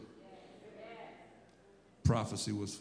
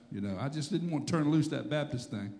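ordinary delivery

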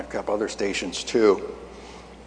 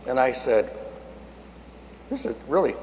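ordinary talk, nothing marked

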